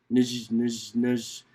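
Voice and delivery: low chuckling nudge, nudge; a deep-voiced lion laughing it off; lion chuckling at the iguana; lion's laugh. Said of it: "laughed the lion".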